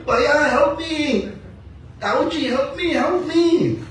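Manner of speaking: high, slow whining plea; a little boy begging for help; child Krishna crying. Acted. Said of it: "Krishna keeps crying and crying".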